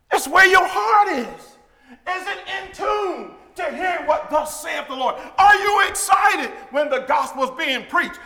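loud, high, fervent preacher's cry; preaching at full force. Acted. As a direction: rise and call it out to the congregation.

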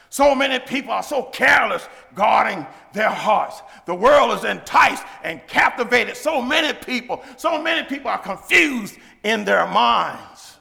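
loud fervent lament; preaching at full force. Acted. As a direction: call it out over the crowd. Preaching loudly.

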